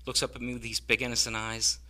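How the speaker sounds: ordinary talk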